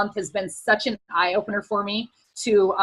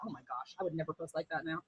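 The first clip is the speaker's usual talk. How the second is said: put-on low, hushed cringe at her old self; muttered thought; 6 semitones lower, 16 dB quieter than usual. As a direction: mutter it to yourself. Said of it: "and I thought".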